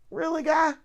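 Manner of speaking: high-pitched disbelieving question; incredulous reproach. Real, not acted. Woman to careless coworker who gave them covid, incredulous.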